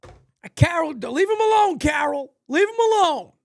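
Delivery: yelling at the TV to stop Carol; pleading. Real, not acted. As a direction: beg Carol to leave him alone.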